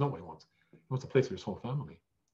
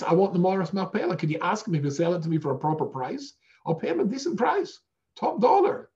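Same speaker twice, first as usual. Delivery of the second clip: eager excited buyer; 7 semitones higher, 6 dB louder than usual. Retold or imitated. Avram eagerly asks for the cave.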